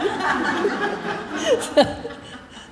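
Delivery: long high giggling laugh. Real, not acted. After talking back to Swami, a long high laugh.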